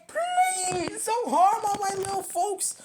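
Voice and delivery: high pleading cry; begging. Acted. Bear pleading with the plant to spare his folks. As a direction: beg desperately. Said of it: "and begged".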